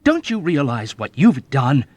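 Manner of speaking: loud, vehement scolding; young officer scolding the old man. A narrator's reading. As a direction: scold him with force. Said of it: "he scolded vehemently".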